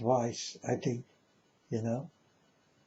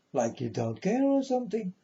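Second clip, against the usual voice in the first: ordinary talk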